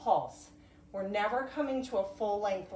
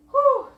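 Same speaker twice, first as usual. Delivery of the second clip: whew of effort; 15 dB louder than usual. Real, not acted. Effortful final reps of lunges.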